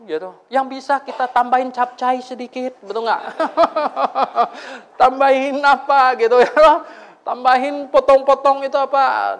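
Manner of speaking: high, bright playful sermon riff; lively preaching. Acted. Preacher joking with the congregation about rice porridge, lively and high. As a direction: tease the crowd with the rice porridge image.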